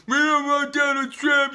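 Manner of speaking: high, loud whiny mocking of a protester; mock crybaby protester. Ranter mocking protesters who chant against Trump, whiny high voice. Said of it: "like a bunch of crybabies saying".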